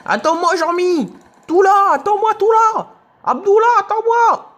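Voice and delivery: high, loud call to wait up. Real, not acted. Calling after teammates Jean-Mi and Abdullah in the game, high loud shout.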